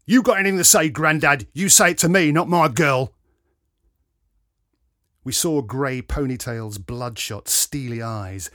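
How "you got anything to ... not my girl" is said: loud, raspy, higher-pitched challenge; rasping bellow. A narrator's reading. Lout confronting an older man at a concert, rasping bellow. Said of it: "a rasping voice bellowed".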